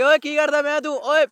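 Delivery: high loud Punjabi call; shouting out. Real, not acted. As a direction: holler at him from afar. Calls out to boy in Punjabi.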